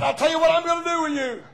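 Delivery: loud, high declaration building to a shout; owner's proclamation. Retold or imitated. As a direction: proclaim the sentence with full force. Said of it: "He said".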